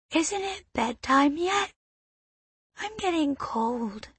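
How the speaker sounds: put-on a drowsy child whining for bed; sleepy little girl. A narrator's reading. Cold little girl asking the boy for bed, high whine.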